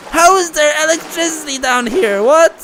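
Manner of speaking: panicked high yells; startled, frightened outbursts; scared shouting. Real, not acted. Horror game scare, screaming reactions.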